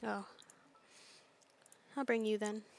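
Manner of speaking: ordinary delivery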